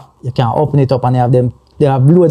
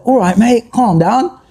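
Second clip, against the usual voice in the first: higher-pitched mock English dad; English dad accent; 6 semitones higher, slower than usual. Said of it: "when we used to do the English dad".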